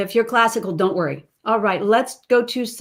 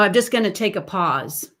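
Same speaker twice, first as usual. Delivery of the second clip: usual speaking voice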